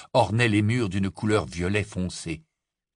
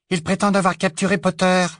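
high, loud cold woman; icy voice; 10 semitones higher, 5 dB louder, faster than usual. Acted. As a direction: say it icily. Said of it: "de sa voix glacée".

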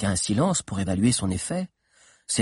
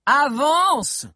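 high, forceful "move on"; sharp rise in pitch, crying out; impatient shout; 14 semitones higher, 5 dB louder than usual. A narrator's reading. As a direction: yell to hurry him up. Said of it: "cria Sabi".

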